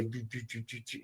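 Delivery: very soft, repeated mechanical doo-doo; imitated garage door. Burglar hears the garage door opening, softly imitated motor noise. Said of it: "it's the car door, the garage door opening up".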